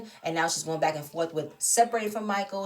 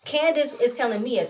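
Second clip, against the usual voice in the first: usual speaking voice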